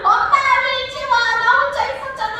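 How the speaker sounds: imitated crying kid, very high and loud; hammed-up tearful child's wail. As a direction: bawl reproachfully at mom like a small child. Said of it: "그러면서 막 우는데".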